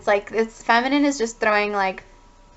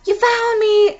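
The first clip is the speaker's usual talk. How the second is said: very high, louder squeal of a call; mock-scared call; 11 semitones higher, 5 dB louder, slower than usual. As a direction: squeal it out as if jumping from hiding. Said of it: "shaking shitless".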